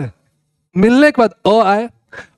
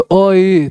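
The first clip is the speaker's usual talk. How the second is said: slow glide from "अ" into "ई"; drawn-out vowels; 2 semitones lower than usual. Shown as a demonstration. Teacher showing students to learn by saying, drawn out. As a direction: hold "अ, ई" as one stretched sound. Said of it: "थोड़ा सा बोल के देखिएगा".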